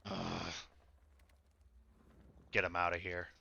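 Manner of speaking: low, soft orc grumble; gruff orc. Acted. Orc wanting him outta here, low gruff grumble. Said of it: "the gruff voice was the orc".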